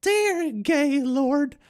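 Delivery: high, very slow mock-solemn invocation; mock prayer. Streamer mocking a chat name as a prayer, mock-solemn. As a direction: intone it like the start of a prayer. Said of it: "Is that how you start your prayers?".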